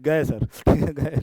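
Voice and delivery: slow words broken by a laugh. Real, not acted. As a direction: chuckle through the words. Joking about the video cutting out.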